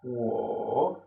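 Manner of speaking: wo dipped low then rising; third tone wo. Shown as a demonstration. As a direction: let wo dip down and come back up. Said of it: "Baja y sube".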